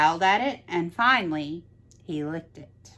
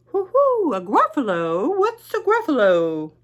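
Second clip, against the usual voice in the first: high, louder hooting owl voice; an owl calling down sweetly; 8 semitones higher, 6 dB louder than usual. Acted. Owl to the mouse, high hooting invitation.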